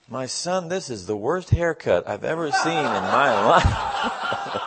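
slow, solemn blessing breaking into a laugh; laughing through the Pope's words. Retold or imitated. Pope blessing Johnny, solemn then laughing. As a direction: intone it gravely, then crack up. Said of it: "and he said".

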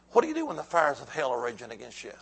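ordinary delivery

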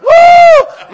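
very high, very loud celebratory woo; joyful whoop. Retold or imitated. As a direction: let out a huge joyful shout. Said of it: "My God, I said, that is shout".

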